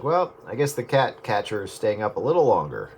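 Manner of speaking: ordinary talk, nothing marked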